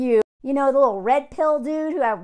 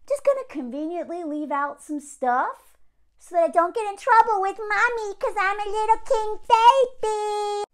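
put-on whiny little-boy voice rising high; mock king baby; 8 semitones higher than usual. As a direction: play a sneaky mama's boy, pitched up. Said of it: "men are lying through omission".

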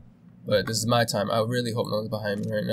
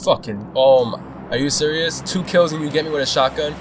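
loud, rising disbelief; loud gamer frustration; exasperated outburst; 4 semitones higher, 6 dB louder than usual. Real, not acted. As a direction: protest an unfair death loudly. Killed in game, protests.

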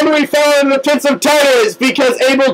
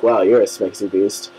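much lower, softer, mock-seductive; deep flirty Abel; 5 dB quieter than usual. Abel complimenting Hades, flirting in a much lower, softer voice. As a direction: flirt with the devil in a sunken voice.

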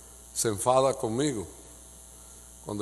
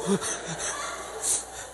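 soft laugh; 4 semitones higher, 8 dB quieter than usual. Real, not acted.

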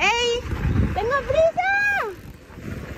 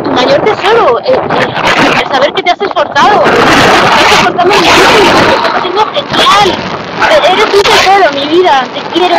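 loud, gushing praise to her daughter; loud mock-tearful congratulations; hammed-up proud mum; 10 dB louder than usual. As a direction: overdo the doting mother, almost crying.

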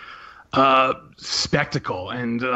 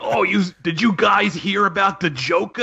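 a mildly raised, put-on generic gangster accent; mock a hammy gangster asking about the Joker; lightly done stage-mobster accent; community theater gangster accent; 7 semitones higher than usual. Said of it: "community theater accent".

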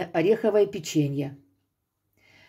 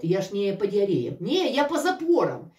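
ordinary talk, nothing marked

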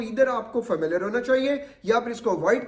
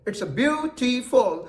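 slow demo word; 3 semitones higher, much slower than usual. Shown as a demonstration. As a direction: say "beautiful" very slowly, syllable by syllable. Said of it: "जैसे मैं word बोल रहा हूं beautiful". ("beautiful" धीरे-धीरे, हर syllable अलग बोलें)

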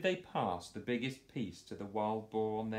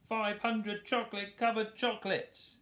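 much higher, louder boar voice; roaring boar; 13 semitones higher, 4 dB louder than usual. Acted. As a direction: bellow it greedily as a boar. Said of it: "roars Boris".

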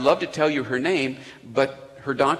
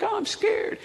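high squeaky mock fright; a high, silly put-on fright; mock scared.

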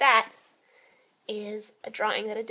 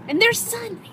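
high bright excited echo; 12 semitones higher, 7 dB louder than usual. Real, not acted. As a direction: echo it, delighted. Excited echo about sunshine.

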